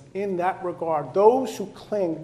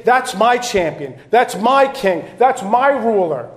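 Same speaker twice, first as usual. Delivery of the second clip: proud declaration; 4 semitones higher, 7 dB louder than usual. Acted. Declaring allegiance in sermon.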